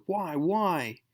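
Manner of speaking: Spider-Man in anguish over his friend, crying out. Acted. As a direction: ask it in despair. Spider-Man anguished while beating foe.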